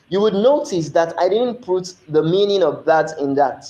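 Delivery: ordinary talk, nothing marked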